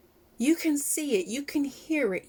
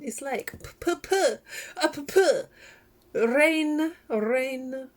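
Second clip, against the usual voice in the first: laughing; 4 semitones higher, 5 dB louder, much slower than usual. Real, not acted. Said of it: "since I turned vegan".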